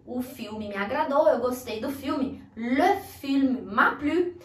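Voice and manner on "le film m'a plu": "Le film m'a plu" slow with rounded "le"; careful repeat for students; slow model phrase. Shown as a demonstration. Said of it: "o le".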